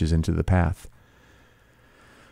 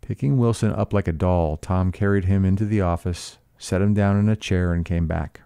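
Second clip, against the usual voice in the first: plain reading voice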